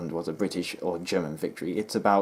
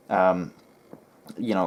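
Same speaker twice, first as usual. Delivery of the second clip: usual speaking voice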